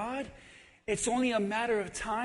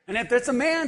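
usual speaking voice

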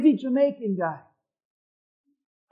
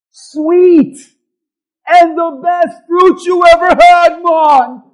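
Jamaican neighbor; 4 semitones higher, 9 dB louder, slower than usual. Retold or imitated. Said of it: "this Jamaican guy says".

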